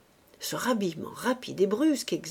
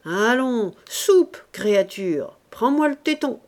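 loud, high-pitched urging; gruffly commanding; 3 semitones higher, 7 dB louder, slower than usual. Acted. Man offers the makeshift bottle to the baby, gruffly commanding. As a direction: order the baby to feed, impatiently. Ursus orders baby to drink.

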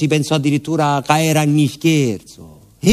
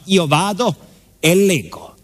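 usual speaking voice

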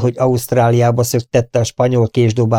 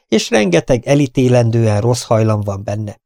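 ordinary delivery

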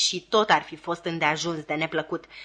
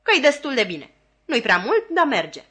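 curt reply; 5 semitones higher, 5 dB louder than usual. A narrator's reading. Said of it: "replică sora mea scurt".

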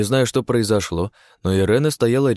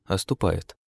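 plain reading voice

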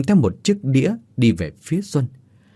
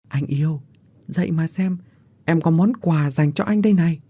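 sweetly taunting wake-up call; coaxing; 6 semitones higher than usual. Acted. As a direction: coax a lover awake. Villain coaxes lover awake.

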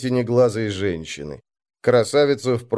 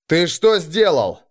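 higher, slow angry bark; barked demand; 6 semitones higher, 3 dB louder than usual. A narrator's reading. Arngrim confronts Moroz over the fallen man, barking. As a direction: demand an answer, sharply but contained. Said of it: "рявкнул Арнгрим".

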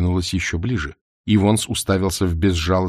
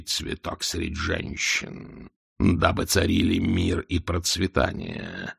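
slow, low dragon; slow haughty dragon; 3 semitones lower, 4 dB quieter, slower than usual. A narrator's reading.

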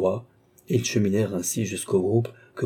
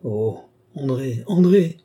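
rising to a loud, high cry of the name; anguished exclamation; 5 semitones higher, 4 dB louder than usual. A narrator's reading. Brother finding a scrap of dress, anguished cry. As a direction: draw out the name, then cry it out. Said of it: "poussa un sanglot déchirant".

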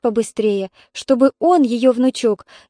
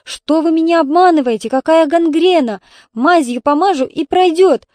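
narrated shouting, a bit louder; 5 semitones higher, 4 dB louder than usual. A narrator's reading. Тётя Рая protests to the doctor, read with raised voice. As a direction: push back at the doctor, voice raised. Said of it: "закричала она".

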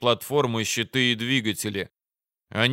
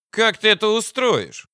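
high-voiced sceptical commander; suspicious, pressing question; doubtful probing; 10 semitones higher, 4 dB louder than usual. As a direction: demand an answer, pitched well up. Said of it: "стал допытываться командир с сомнением".

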